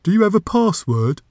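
high-pitched questioning door creature; magic door voice. A narrator's reading. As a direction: ask it as a put-on magic door.

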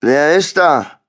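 ponderous voice. A narrator's reading. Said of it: "Die behäbige Stimme gehörte Mister Huffman".

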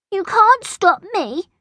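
put-on high-pitched childish protest; acted playground defiance; defiant little girl. A narrator's reading. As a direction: argue back in a girl's high voice. Angela defies Bertie after sniffing.

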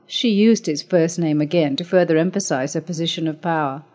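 ordinary delivery, nothing marked

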